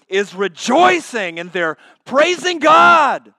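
climbing pulpit fervour; rising preaching. Acted. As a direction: lift the church with it. Preaching builds up.

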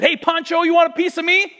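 high, loud mock challenge; taunting classmate. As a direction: taunt him into a fight. Classmate picking a fight.

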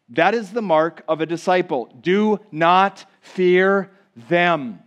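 ordinary delivery

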